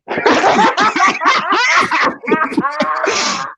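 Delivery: high, long comic wail; laughing imitation of crying; mock bawling. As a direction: burst into hammed-up sobs. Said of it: "I bust out into tears crying".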